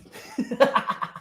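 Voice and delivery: a quick airless chuckle at his own bit; breathy laugh. Real, not acted. After his mock outrage, a quick airless laugh.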